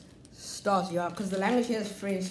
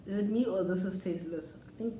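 talking with mouth full; eating snack while speaking; chewing biscuit; faster than usual. Real, not acted. Seen 0: Bites biscuit before and at 1.5s; holds biscuit, eating throughout.

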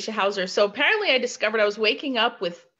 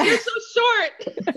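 much higher, slow, laughing mock reply; teasing comeback imitated; 13 semitones higher, much slower than usual. Retelling a friend's playful jab at their first meeting, high slow laughing imitation. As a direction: draw out the playful retort through a laugh. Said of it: "She goes".

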